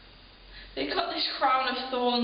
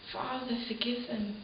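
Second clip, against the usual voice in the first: much softer, low, fading words; dying plea; 3 semitones lower, 7 dB quieter than usual. Acted. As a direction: breathe it out as a last prayer. Jesus speaking on the cross.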